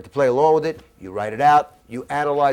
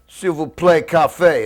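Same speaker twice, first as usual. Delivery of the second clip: clumsy tourist French; 7 dB louder, slower than usual. Retold or imitated. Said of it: "and you say".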